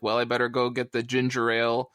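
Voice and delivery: ordinary delivery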